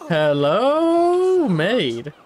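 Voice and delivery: high, loud singsong hello; calling out. Pretend. Player calling the maid, high singsong call. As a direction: sing it out loudly to summon someone.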